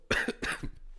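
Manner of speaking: sudden coughing fit. Real, not acted. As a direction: cough hard. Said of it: "Wow, excuse me".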